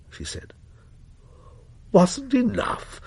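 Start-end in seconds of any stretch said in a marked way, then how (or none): 1.9-3.0 incredulous. A narrator's reading. Father repeats in disbelief.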